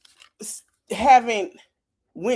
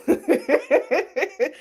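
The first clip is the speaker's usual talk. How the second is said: laughing; 3 semitones higher than usual. Real, not acted. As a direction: laugh helplessly at your own joke. Laughing at own Florida joke.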